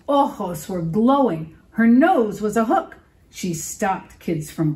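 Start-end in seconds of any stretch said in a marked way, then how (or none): none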